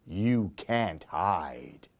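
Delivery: an ominous low voice from the crystal ball; slow, low and hushed warning. A narrator's reading. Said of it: "Her crystal ball says".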